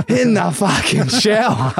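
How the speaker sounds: high, slow disbelieving coach; imitating the coach. Coach reacting to Kepler, slow, high disbelief. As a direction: drag it out high in mock disbelief. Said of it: "And he goes".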